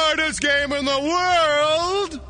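announcer voice. Acted. Announcer catchphrase repeated.

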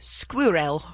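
"Squirrel" with a rounded first vowel and clear "-rel"; British pronunciation. Shown as a demonstration. Colleague showing how Brits say squirrel, British pronunciation. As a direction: say "Squirrel" as two syllables, skwu-rel. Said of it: "how do the Brits say squirrel?".

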